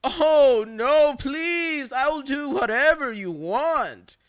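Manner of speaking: high, whiny fake sobbing plea; hammed-up fake crying to the caller. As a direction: ham up a begging victim. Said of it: "fake crying voice".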